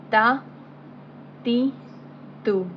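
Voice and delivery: ta, ti, tu said as clear short syllables; crisp Arabic tajweed drill: ta, ti, tu. Shown as a demonstration. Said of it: "We're not going to spell it".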